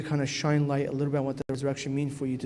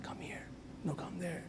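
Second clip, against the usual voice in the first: devil's near-whisper, far softer; luring devil; 4 semitones higher, 14 dB quieter than usual. Retold or imitated. Devil luring people astray in darkness, hushed. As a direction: coax them into the dark, hushed. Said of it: "He's like".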